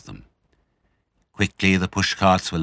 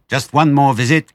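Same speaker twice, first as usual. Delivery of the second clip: high and loud; eager cry; 7 semitones higher, 8 dB louder than usual. A narrator's reading. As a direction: call out, keen for one more raid. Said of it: "cried Mister Fox".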